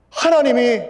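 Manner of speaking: 하나님이 shouted high and loud alone; stress on 하나님이. Acted. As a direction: hit 하나님이 hard and high, then let it fall. Key word shouted alone. (하나님이를 크고 높게 힘주어)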